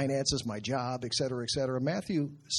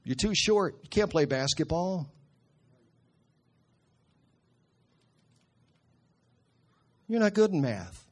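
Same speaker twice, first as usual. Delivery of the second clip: mock critical voices; 4 semitones higher than usual. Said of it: "the world told us things".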